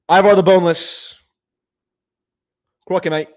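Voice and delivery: a touch of mock Australian; Australian accent. Streamer joking about donation currency, Australian accent. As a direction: put on a light Australian accent. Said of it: "That's Australian".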